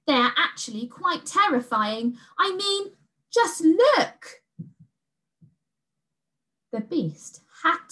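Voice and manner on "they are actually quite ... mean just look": high, rising to a loud "just look"; emphatic girl. A narrator's reading. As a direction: insist the bunnies are scary. Girl points to scary bunnies.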